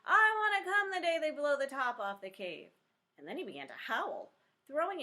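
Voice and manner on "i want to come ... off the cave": high, loud tantrum yell; a small boy screaming at the top of his voice; demanding to come on blast day, full yell. Acted. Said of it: "yelling at the top of his voice".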